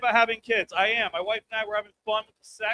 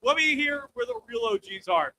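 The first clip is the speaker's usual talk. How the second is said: ordinary delivery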